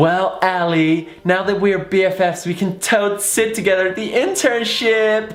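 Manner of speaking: very high, drawn-out mock-gushing voice; slow, squealy parody of friendliness; hammed-up gushing friend. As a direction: overplay an excited new BFF. Hammed-up gushing new best friend.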